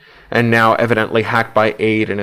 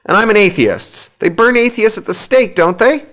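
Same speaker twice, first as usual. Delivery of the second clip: wailing; 11 semitones higher than usual. A narrator's reading. Hugh wails to Adrian about atheists burning, high panicked pitch. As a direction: keep wailing, pleading for reassurance. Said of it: "Hugh wails".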